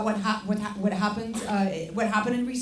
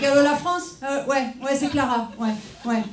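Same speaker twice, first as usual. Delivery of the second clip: raised, quick French chatter; French phone greeting; 4 semitones higher than usual. Pretend. Comedian pretending to ring France, lively French-language greeting. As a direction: open a pretend call in native French.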